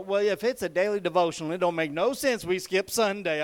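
put-on loud, emphatic Louisiana accent. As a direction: imitate a Louisiana woman laying down the law, loudly. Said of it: "in her Louisiana accent".

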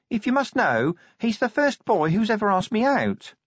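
a touch of sadness, high; sad. A narrator's reading. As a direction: admit it a little sadly. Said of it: "she added sadly".

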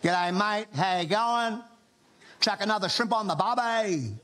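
hammed-up imitation of an Australian accent; bad Australian accent. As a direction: mimic someone badly faking an Australian accent. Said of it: "they will do an Australian accent".

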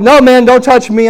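high, loud refusal; imitated panicky warning; alarmed protest. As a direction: shout to keep someone back. Frightened brother refuses to be touched.

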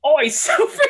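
a hammed-up Cockney "Oi".

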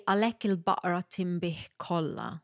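Maltese phrase in native Maltese accent. Real, not acted. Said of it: "make fun of my Maltese accent".

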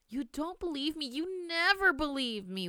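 put-on high, slow wailing child; wailed. Girl wailing at her disbelieving grandmother, high childish wail. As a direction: wail it like a wronged little girl. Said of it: "wailed Maria Lucia".

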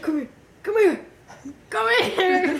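loud, high mock call to a child; grandfather calling. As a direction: imitate grandpa calling, laughing near the end. Acting grandfather calling the kid.

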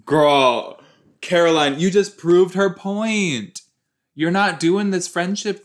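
exasperated scold; loud scolding. Real, not acted. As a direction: call her out across the room. Scolding a character on screen.

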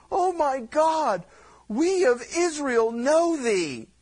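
loud, high and slow, pleading to God; desperate cry to God. Acted. Israel crying out to God: loud slow wailing plea.

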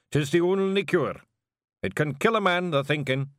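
mock-solemn diagnosis, loud; Big Yan's Scots. Big Yan tells Jeanie Rob caught thinking, in a loud mock-grave Scots voice.

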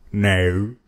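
mock Australian accent, vowel bent to "naur". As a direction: imitate an Australian saying "naur". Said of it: "I know this Australian woman".

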